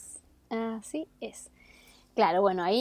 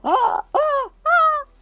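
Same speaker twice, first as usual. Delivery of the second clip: three screams climbing higher and louder; rising screams; 9 dB louder, much slower than usual. Retold or imitated. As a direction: scream in terror, each higher. Acting out Cristina's screams.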